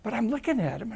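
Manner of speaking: ordinary talk, nothing marked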